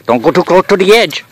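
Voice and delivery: calling out to Petey to keep back from the edge; calling out a warning. Real, not acted. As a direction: shout a worried warning across the distance. Warning Petey near a cliff edge.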